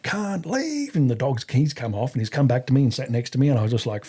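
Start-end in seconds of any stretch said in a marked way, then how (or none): 0.0-1.0 high-pitched retold call to a dog; calling out command. Handler calling his dog off a policeman, high retold command. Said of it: "calling out".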